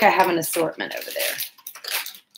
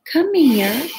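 high, sing-song call to a pet; high coaxing call to the cat; 9 semitones higher, 4 dB louder than usual. Real, not acted. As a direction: coax the cat over in a lifted voice. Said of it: "that's how I say, "Come here," to Loki".

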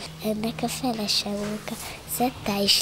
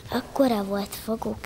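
usual speaking voice

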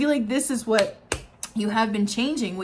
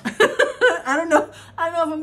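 overwhelmed laugh; 7 semitones higher, 6 dB louder than usual. Real, not acted. Tarot reader laughing with joy for Cancer. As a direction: laugh through the words, overcome with joy. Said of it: "graceful and articulate".

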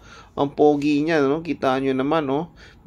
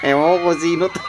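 laughing; 5 semitones higher than usual. Real, not acted.

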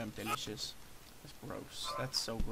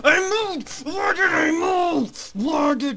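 much higher, louder mock crying wail, slow; hammed-up wail; 11 semitones higher, 9 dB louder, slower than usual. Player dying after moving, hammed-up crying wail. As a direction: ham up a despairing sob.

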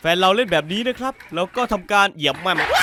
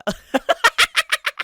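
giggle; 3 dB louder than usual. Real, not acted. Laugh after trick.

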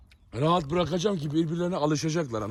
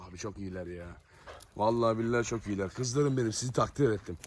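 low, soft, affectionate murmur; tender praise; 7 semitones lower, 6 dB quieter than usual. Real, not acted. Owner proud of the two dogs getting along, tender. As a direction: praise your dogs softly and fondly.

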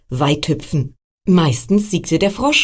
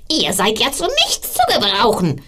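higher witch voice, a mild mock scolding; angry witch; 5 semitones higher than usual. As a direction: play the cross old witch, scolding lightly. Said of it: "schrie sie die Schuhe an".

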